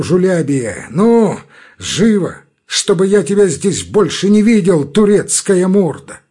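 loud angry shout. A narrator's reading. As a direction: yell him out of the room. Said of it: "он крикнул".

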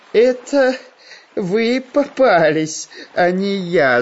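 high, slow villain voice laughing through the words; gloating laugh. A narrator's reading. The hidden plant lord mocks the travelers, laughing triumphantly. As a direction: cackle the taunt back at them. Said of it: "захохотал голос".

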